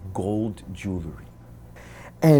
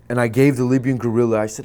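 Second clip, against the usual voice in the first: ordinary delivery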